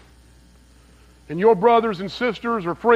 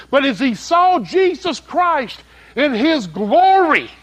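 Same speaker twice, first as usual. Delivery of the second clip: high, louder impassioned sermon voice; fiery preaching; 6 semitones higher, 3 dB louder than usual. Acted. Preacher telling the thieves on the cross, impassioned preaching. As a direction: paint the scene at full pulpit force.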